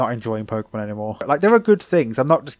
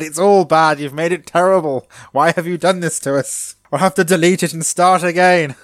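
raised, louder hammed-up dismay; mock despair; 5 semitones higher, 6 dB louder than usual. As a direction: wail about the disaster in play-acted despair. Mock despair at ruined outro.

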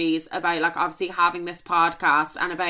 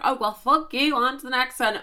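defiant brush-off; 6 semitones higher than usual. Pretend. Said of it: "as much as I wanna say".

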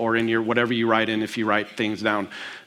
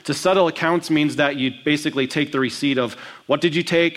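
normal talking voice

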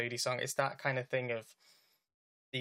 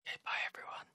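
whispered goodbye; 5 dB quieter, much faster than usual. Acted. Whispered then shouted sign-off goodbyes.

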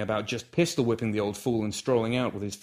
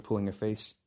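ordinary delivery, nothing marked

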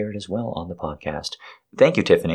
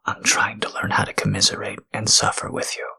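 intimate whisper. Acted. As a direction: whisper it like a tender apology.

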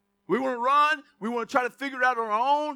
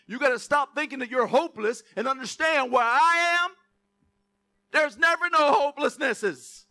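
louder, rising preacher's build; preaching at full force; 6 dB louder than usual. Acted. Pastor rousing the congregation about hope, full preaching voice. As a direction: drive the hope message home.

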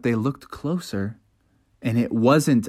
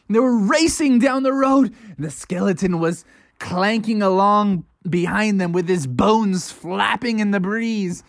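plain reading voice

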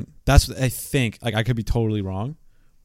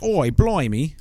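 hammed-up British accent, a bit louder; tossing off a jokey Liverpool accent; mock Scouse; 6 semitones higher, 5 dB louder, much slower than usual. Mimicking Liverpool slang for a friend, mock Scouse accent. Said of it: "It's like".

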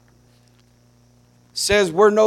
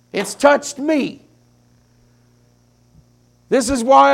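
higher-pitched, fervent pulpit preaching; impassioned preaching; 5 semitones higher, 4 dB louder than usual. Real, not acted. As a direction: preach it with fervent conviction in long, driving phrases. Preaches at rising intensity.

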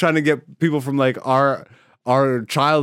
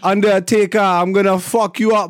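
raised, louder mock Jamaican accent; 5 semitones higher, 4 dB louder, slower than usual. Podcaster imitating wrestler's old gimmick, Jamaican accent. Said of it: "he used to talk like this".

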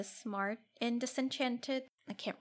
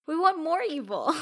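mock demand; 7 semitones higher, 7 dB louder than usual. Playful demand for villainy.

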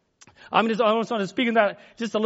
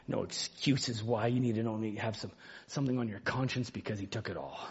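much softer, lower preaching over music; soft altar call; 12 semitones lower, 9 dB quieter than usual. Acted. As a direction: ease the room into quiet prayer.

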